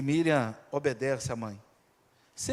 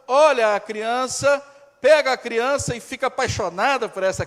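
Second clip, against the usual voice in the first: impassioned preaching; 7 semitones higher, 9 dB louder than usual. Real, not acted. Impassioned sermon retelling.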